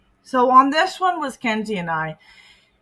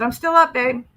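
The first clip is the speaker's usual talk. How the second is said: pushy needy voice; 4 semitones higher, 6 dB louder than usual. Retold or imitated. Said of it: "He said".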